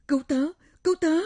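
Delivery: high, loud cry for help; desperate wail. Acted. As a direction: scream for help, pleading. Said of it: "kêu gào thống thiết".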